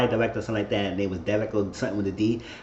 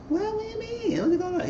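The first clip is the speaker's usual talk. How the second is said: much higher, softer put-on woman's voice; high mock character voice; 5 dB quieter than usual. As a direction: squeak it out like the flustered snitch. Voicing Charity's reply to Grace.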